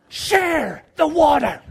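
high, loud, slow command; dad ordering his kids to share; stern shout. Retold or imitated.

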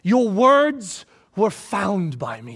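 ordinary talk

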